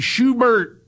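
high, sing-song Schubert; friend's greeting. Retold or imitated. Imitating his friend greeting him by nickname, high. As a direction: answer back in the friend's call. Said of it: "he calls me Schubert".

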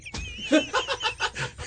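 airy unvoiced laughter; breathy laugh. Real, not acted. Laughs at wrong-way fleet.

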